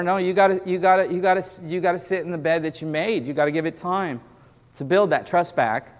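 ordinary delivery

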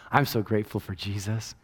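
ordinary talk

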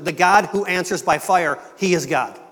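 loud, ringing quote of Murray's sermon; preaching. Retold or imitated. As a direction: proclaim it like a street preacher. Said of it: "Murray preached his sermon".

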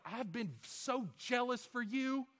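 mock Elijah's self-pitying whine; high mocking whine, Elijah feeling sorry for himself; whiny self-pity. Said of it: "He's like".

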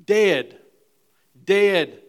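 preacher stressing dead; emphatic repeat. Acted. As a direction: hammer dead home. Preacher hammers the word.

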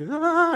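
high, loud mock-panic "ah"; panicked cry. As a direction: yelp like someone freaking out. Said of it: "They're freaking out".

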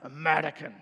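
muttered low in a mock Russian accent; a lightly done Russian accent, under the breath; mock Russian muttering in passing. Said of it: "he muttered under his breath".

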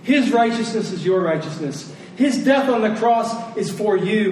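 usual speaking voice

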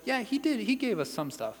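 high start, shrugging fall; grudging little concession, tossed away; mock offhand acknowledgement. Preacher voicing Eve simply acknowledging God's gift, offhand. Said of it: "Eve simply acknowledges".

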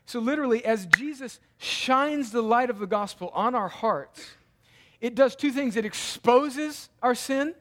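loud, high-pitched, urgent preaching; full-force preaching. Real, not acted. Preacher pleading with congregation to see the light transforms, full force. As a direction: drive each point home with punched words.

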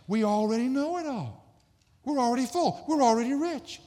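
high-pitched know-it-all boasting; mock arrogance. Preacher mimicking degree-holders who think they know it all, mock arrogance. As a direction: crow it, voice pushed up high. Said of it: "That's that arrogance".

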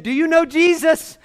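high, loud, excited question; eager urgency. Retold or imitated. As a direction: ask urgently, having just run over. Monk runs up, urgently asking.

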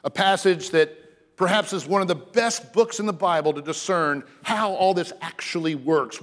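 normal talking voice

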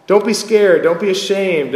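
a father firmly telling him to take heart; Paul encouraging timid Timothy, loud and urgent; fatherly encouragement. Retold or imitated. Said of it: "needed frequent encouragement by his".

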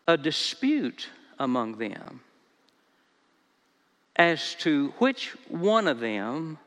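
slow, measured Bible reading with long pauses; scripture reading. Acted. Preacher reads the passage to the congregation, slow scripture reading. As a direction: read the verse out slowly, softening toward the end.

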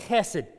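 "Chesed" with a throaty, scraped ch; throaty Hebrew sound. Shown as a demonstration. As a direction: say "Chesed" like something's in the back of your throat. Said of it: "like there's something in the back of your throat".

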